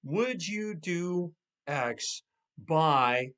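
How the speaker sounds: very slow, each word laid out; slow model request. Shown as a demonstration. Coach showing the time part of a request, very slow. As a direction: draw out the by. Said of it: "here's the second part of the request".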